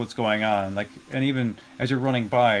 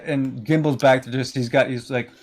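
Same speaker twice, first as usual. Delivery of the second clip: ordinary talk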